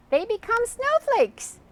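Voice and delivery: high, eager exclaim. Acted. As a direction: blurt the answer out proudly. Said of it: "exclaimed Donna".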